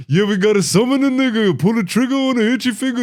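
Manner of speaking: mock [name] rap; [name] lyric. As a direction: spit it like an old [name] verse. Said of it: "some fucking [name] lyric".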